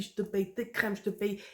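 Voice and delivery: ordinary talk, nothing marked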